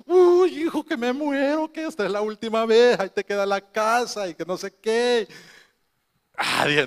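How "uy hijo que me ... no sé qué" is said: high, wailing mock farewell; hammed-up, high-pitched plaintive moan; mother's dying lament. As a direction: imitate a mother melodramatically dying on a voice message. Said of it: "un audio que me mandó".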